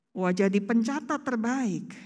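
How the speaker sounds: ordinary talk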